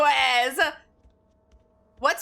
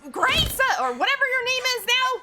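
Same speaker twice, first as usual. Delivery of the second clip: panicked scream; 10 semitones higher than usual. Real, not acted. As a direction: yell in real panic. Hears monster laughing, screams.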